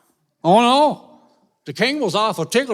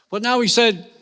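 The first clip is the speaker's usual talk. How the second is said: ordinary delivery, nothing marked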